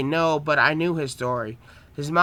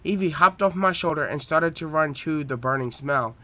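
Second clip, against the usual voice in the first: plain reading voice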